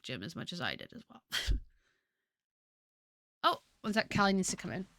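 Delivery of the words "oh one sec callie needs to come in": ordinary talk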